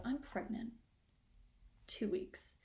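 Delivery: soft, breathy-light mock ad voice; dainty commercial lady. Comic mimicking a woman in an ad, soft and airy. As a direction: play the airy lady at brunch. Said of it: "Woman number one goes".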